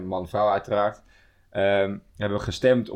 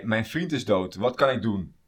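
ordinary talk, nothing marked

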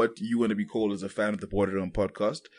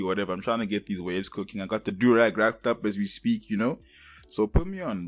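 ordinary talk

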